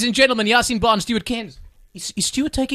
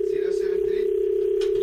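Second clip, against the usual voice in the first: whisper; 11 semitones higher, 6 dB quieter, slower than usual. Real, not acted. Whispering the number off air.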